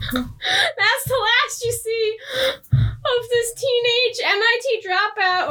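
laughing so hard the voice goes high; words squeezed out through giggles; slow, high, laughing helplessly. Real, not acted. Cracking up at the absurd plot.

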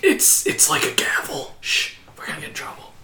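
secretive whisper; hushed whisper. Pretend. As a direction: whisper so you don't get caught. Crowd is silent, hushing each other.

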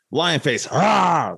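playful mock lion roar; lion face roar. Host does a silly lion face warm-up roar. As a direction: open wide and roar the rah. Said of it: "my vocal warm-ups".

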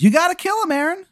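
very high squeaky falsetto; Timmy the Tooth. Acted. Tooth tells Aaron to kill the captive, very high. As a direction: urge it in a tiny high voice. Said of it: "And he goes".

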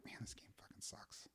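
barely audible grumbled curse; whispered quote. Retold or imitated. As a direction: whisper the complaint under your breath. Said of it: "I'm like".

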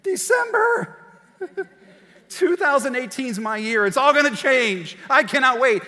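high, loud giddy build-up, slowed for effect; hammed-up giddy excitement; mock new year hype. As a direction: gush like it's the best year ever.

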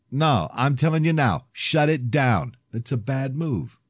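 slow, heavy stressed order; emphatic warning. Acted. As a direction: spell it out slowly, hard. Final heated warning.

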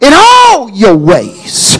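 slow, loud proclaiming of the verse; quoting Solomon loudly. Retold or imitated. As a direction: declare it like Solomon. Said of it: "Solomon said".

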